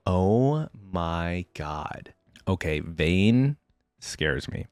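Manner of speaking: very slow, low disbelief; stunned reaction. Real, not acted. Reader reacts to Vayne killing Frey, stunned. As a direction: let it sink in, drawn out and hushed by the end.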